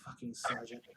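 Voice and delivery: low-volume grumbled aside; under his breath. Acted. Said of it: "under his breath".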